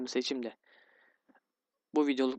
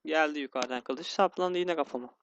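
normal talking voice

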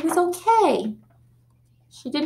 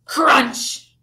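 crunch; 3 semitones higher than usual. A narrator's reading. Shark bites the table.

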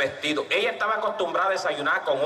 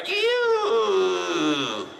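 stomach rumble noise. Acted. Stomach rumbling sound imitated.

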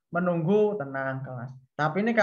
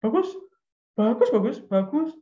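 repeated stock praise, mock monotony; the same word of praise on repeat; repetitive praise; 4 semitones higher, 3 dB louder than usual. Shown as a demonstration. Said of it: "Jangan monoton misalnya".

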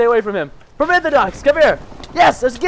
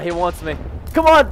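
ordinary talk

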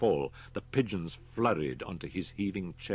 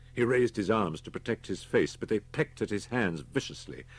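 plain reading voice

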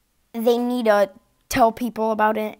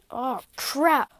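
ordinary talk, nothing marked

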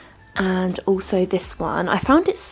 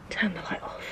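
whispered; 4 semitones lower, 11 dB quieter than usual. Real, not acted. Switches off loft light.

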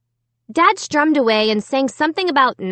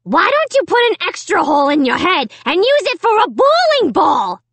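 high, loud puppet screech; screaming dummy; 8 semitones higher, 4 dB louder than usual. A narrator's reading. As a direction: scream the insult as a vicious dummy. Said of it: "Slappy screamed at Dad".

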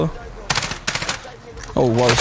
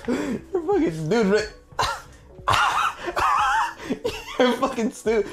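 cracking up mid-insult; helpless high-pitched laughter; laughing in disbelief; 11 semitones higher, 7 dB quieter than usual. Real, not acted. Teammate misses item, laughing.

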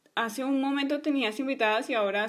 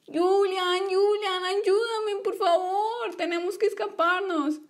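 pleading for help; 7 semitones higher, slower than usual. Acted. Trapped girl begs for help.

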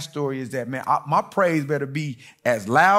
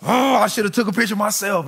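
upset scold; 7 semitones higher, 4 dB louder than usual. Retold or imitated. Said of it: "I said".

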